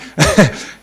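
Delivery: short burst of genuine laughter; real laugh. Real, not acted. Founder laughing while telling the interviewer about a pushy angel investor, short real laugh. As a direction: laugh at the absurd investor story.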